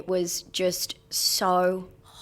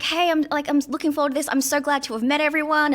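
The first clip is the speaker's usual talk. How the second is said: lightly higher and brighter, imitating a chirpy email; mock cheery director; 7 semitones higher than usual. Mocking the director's group email, a lightly higher chirpy voice. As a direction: play the gushing director, a little up.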